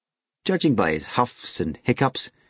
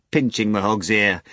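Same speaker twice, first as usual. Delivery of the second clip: plain reading voice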